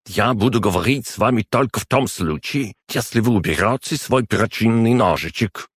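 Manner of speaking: high-pitched, commanding condition; barked ultimatum. A narrator's reading. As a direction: lay down terms with haughty indignation. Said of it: "рявкнул Фламель".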